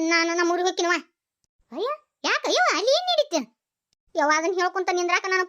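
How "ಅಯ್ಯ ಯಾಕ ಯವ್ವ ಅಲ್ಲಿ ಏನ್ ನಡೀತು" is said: alarmed surprise. Acted. Sister shocked by leaving news.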